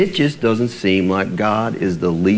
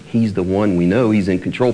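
ordinary delivery

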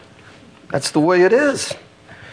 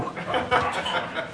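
breathy laugh; 3 semitones higher, 10 dB quieter than usual. Real, not acted. Laugh inside Jacob's plea.

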